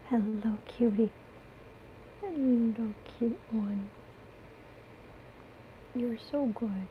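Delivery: quiet whisper to a pet; whispering to cat. Real, not acted. Owner whispering to her cat between questions. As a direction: murmur it softly to the kitty.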